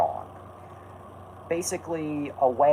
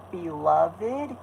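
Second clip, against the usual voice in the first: "beloved" raised high like a question; questioning rise; 7 semitones higher than usual. Shown as a demonstration. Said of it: "you have to say it as a question".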